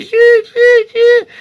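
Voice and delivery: loud falsetto hi hi; shrill-free high loud bird calls; high bird cries. Shown as a demonstration. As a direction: squeal it like a bird. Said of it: "Jojo, il faisait le hi".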